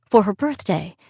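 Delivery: plain reading voice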